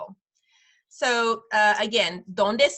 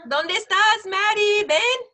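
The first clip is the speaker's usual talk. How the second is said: raised, high-pitched summons; calling out; 9 semitones higher, 5 dB louder, slower than usual. Real, not acted. Calling a child over.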